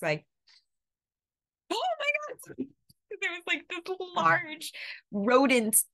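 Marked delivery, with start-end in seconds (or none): 1.7-5.8 very high squeal of fright; retold shriek. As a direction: shriek like you just saw a rat. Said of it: "I'll never forget just".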